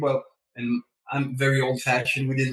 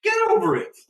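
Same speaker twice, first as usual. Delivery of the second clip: wife's brush-off; 3 dB louder, faster than usual. Retold or imitated. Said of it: "She goes".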